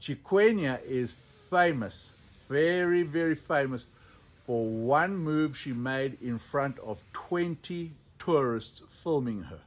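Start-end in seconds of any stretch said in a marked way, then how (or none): none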